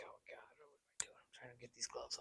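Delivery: breathy whisper to himself; whispered inner thoughts. Retold or imitated. Volunteer sweeping a bridge, nervous, in a whispered inner voice. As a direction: whisper the worry under your breath. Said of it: "I'm like".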